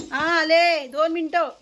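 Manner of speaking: drawn-out shouted reply; calling out. Real, not acted. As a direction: holler to someone waiting. Calls out she is coming.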